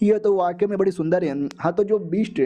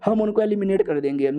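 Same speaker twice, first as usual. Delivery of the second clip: ordinary delivery, nothing marked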